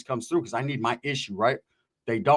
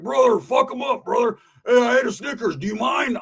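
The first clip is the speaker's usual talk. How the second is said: high, strained put-on shout; hollering cellmate; 8 semitones higher than usual. Cellmate yelling from the toilet during a fight, high mock holler. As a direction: holler it over a brawl.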